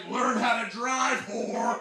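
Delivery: loud, drawn-out road-rage shout; retold angry yell. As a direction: yell it at another driver, hammed-up. Yells at texting driver, retold.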